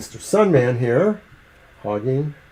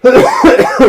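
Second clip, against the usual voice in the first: harsh choking coughs; coughing fit; 9 dB louder than usual. Real, not acted. Choking on peanuts.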